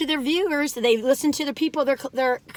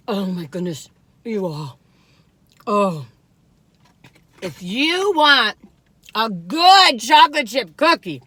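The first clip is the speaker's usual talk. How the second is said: usual speaking voice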